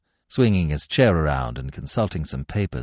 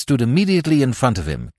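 ordinary delivery

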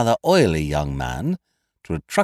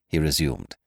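plain reading voice

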